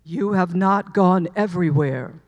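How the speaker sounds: a divine voice correcting her, low and resonant; deep voice of God effect. Acted. God answering her plea, in a deep booming voice. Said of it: "And the voice said".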